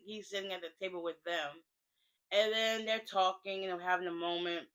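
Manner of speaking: normal talking voice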